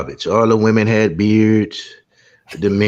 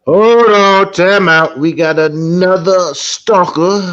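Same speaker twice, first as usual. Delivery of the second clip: raised, higher voice calling a halt; loud interruption; 5 semitones higher, 4 dB louder, slower than usual. Real, not acted. Host halting the talk to call out a stalker, loud announcing voice. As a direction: break in loudly, then stretch out the announcement.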